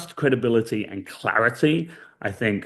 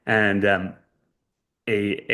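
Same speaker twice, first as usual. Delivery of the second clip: usual speaking voice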